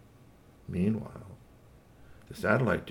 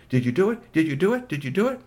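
higher, louder eager repeated question; excited kids; 8 semitones higher, 7 dB louder than usual. Acted. Kids banging on door asking eagerly, higher and louder. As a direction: bounce with impatience.

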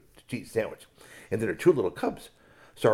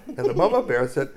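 plain reading voice

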